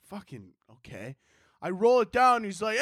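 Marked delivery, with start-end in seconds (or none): 0.1-1.1 low, very soft grumble; quiet, grudging compliance; reluctant mutter. Retold or imitated. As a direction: mumble it under your breath. Reluctant aside as himself, much quieter.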